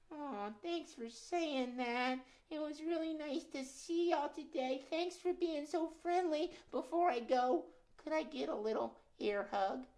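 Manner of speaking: high timid puppet muskrat; nervous puppet. Acted. Puppet Gus speaks.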